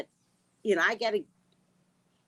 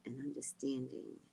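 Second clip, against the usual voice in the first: whispered quote; 3 semitones lower, 9 dB quieter than usual. Retold or imitated. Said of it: "Sky says".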